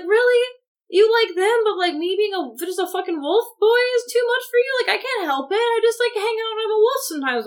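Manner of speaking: high-pitched, offended whine; mock indignant protest. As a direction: plead your case, exaggeratedly aggrieved. Acting out Jacob's hurt protest.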